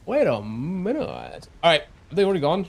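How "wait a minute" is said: stretched slowly, each word drawn. Real, not acted. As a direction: drag out wait a minute. Repeating, drawn out.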